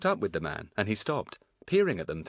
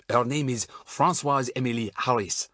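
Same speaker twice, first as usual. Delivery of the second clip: put-on thick French accent, slightly raised; 4 semitones higher, 4 dB louder than usual. A narrator's reading. Man naming his sister to a woman, very French accent. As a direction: go very French on the name. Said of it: "suddenly going very French".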